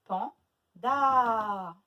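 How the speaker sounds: PANDA as pan, pause, loud long daaa; stretched final syllable. Shown as a demonstration. Teacher confirming where A is heard, stretched syllable. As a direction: hold the last a of Panda. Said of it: "Où est-ce qu'on entend le A?".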